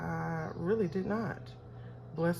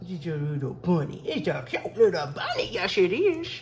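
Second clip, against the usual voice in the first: cooing at bunny. Real, not acted. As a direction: coo sweetly at the little bunny.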